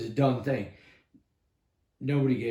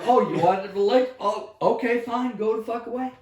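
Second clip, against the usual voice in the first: mocking dismissal; 11 semitones higher, 6 dB louder than usual. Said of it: "And they're like".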